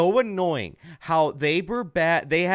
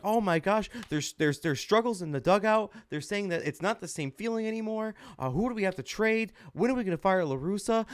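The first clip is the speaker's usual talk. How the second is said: higher, fretful mock fan voice; mock panicky fans; 7 semitones higher than usual. Mocking Sox radio callers fretting over the slump, higher mock-worried voice. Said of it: "they're all like".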